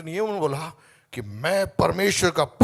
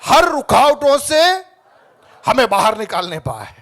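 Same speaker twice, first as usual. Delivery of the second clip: loud declaration; 4 semitones higher, 10 dB louder than usual. Real, not acted. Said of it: "बोलिए". (ज़ोर से धीमी घोषणा)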